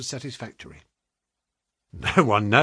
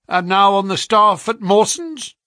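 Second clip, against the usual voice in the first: higher, louder, quick quizzing; brisk questioning agent; 8 semitones higher, 7 dB louder than usual. A narrator's reading. Agent checks the clerk's past, brisk and prying. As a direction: fire the question like a busy agent.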